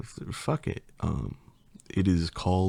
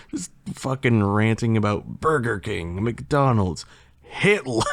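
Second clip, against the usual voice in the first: ordinary talk, nothing marked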